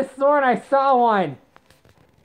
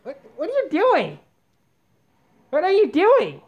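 incredulous scolding, higher than usual; alarmed disbelief; 6 semitones higher than usual. Real, not acted. Owner catching the cat at it, alarmed disbelief. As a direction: pitch leaping high, then falling on "doing".

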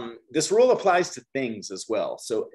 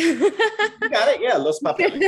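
laughing; 7 dB louder than usual. Real, not acted. Teacher laughing at student's answer.

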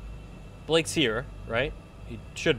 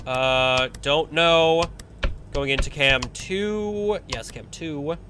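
normal talking voice

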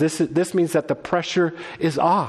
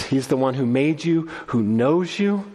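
ordinary delivery

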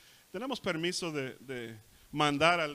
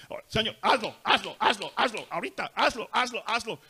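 loud, high, rapid-fire demands; bossy mock prayer; 7 semitones higher, 8 dB louder than usual. Preacher mimics an arrogant prayer ordering Jesus around, loud bossy demands. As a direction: order Him around like a servant, hammed-up. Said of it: "order Jesus around like he's our little servant".

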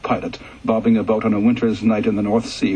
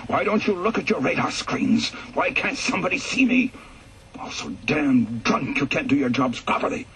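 stranded pilot yelling into a dead microphone, high-pitched but held back in volume; screaming in rage; 5 semitones higher than usual. Acted. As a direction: rage at the radar crews, voice lifting high. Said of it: "I began screaming into the dead microphone".

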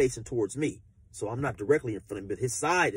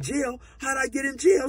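shocked disbelief; 12 semitones higher, 4 dB louder than usual. Retold or imitated. Shocked question.

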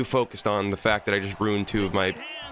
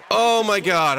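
exasperated outburst; 9 semitones higher, 5 dB louder, slower than usual. Real, not acted. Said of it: "I'm in rare form today".

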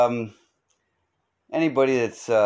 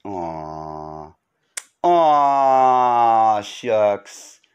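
mock bashful aw; 4 dB louder, much slower than usual. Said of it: "I love you".

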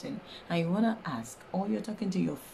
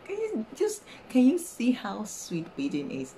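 much higher, thrilled; delighted; 6 semitones higher, 4 dB louder than usual. Real, not acted. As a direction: burst out in delight over the language. Teacher delighted by pidgin.

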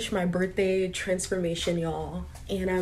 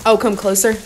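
louder, startled echo; 10 dB louder than usual. Real, not acted. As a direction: blurt it back in alarm. Reacting to waxer's request.

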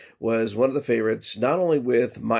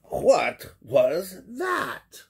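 very slow, higher puzzled question; startled kitten; 8 semitones higher, much slower than usual. Acted. Skippy puzzled by what flashed in the mirror. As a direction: draw it out in wonder.

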